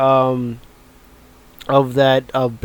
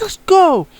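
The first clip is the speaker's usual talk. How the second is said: much higher, pumped-up chant; all hyped. Retold or imitated. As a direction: fire him up, repeat it like a chant. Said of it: "He's all hyped".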